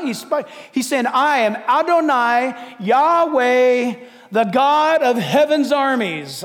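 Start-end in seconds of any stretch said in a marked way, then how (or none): 1.1-6.4 slow, weighty proclamation; God declaring himself. Acted. The Lord of armies to corrupt leaders, slow thunderous declaration. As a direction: draw out each name with force. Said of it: "I like when he talks like that".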